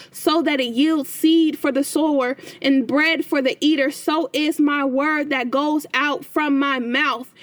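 measured, solemn Bible reading; steady devotional recitation; scripture reading. Acted. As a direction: read the passage like a psalm before the flock. Reads Isaiah 55 aloud.

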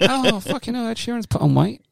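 high excited fan voice; mock fan. Fans mistaking him for [name], high mock voice. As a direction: gasp like a starstruck fan, pitched high.

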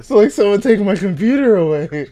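real laughter at his own joke; laughing. Real, not acted. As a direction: crack up while saying it.

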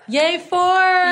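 high, bright little cheer; excited cheer. Real, not acted. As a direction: cheer the milestone. Cheering the episode milestone.

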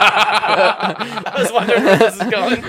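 high laughing words, slow; laughing while saying it; speaking through laughter. Real, not acted. Laughing reaction.

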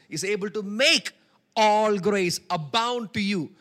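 slow, loud, ringing preacher proclamation; preaching at full force. Acted. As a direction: stretch the verse out loud like a pulpit cry.

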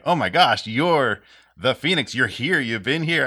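amazed discovery. Retold or imitated. Player voicing his character meeting the Phoenix, amazed. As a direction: act out the character's dawning amazement.

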